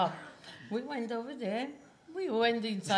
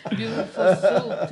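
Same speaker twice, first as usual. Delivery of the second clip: laughing; 12 dB louder than usual. Real, not acted. Mother laughs recalling husband.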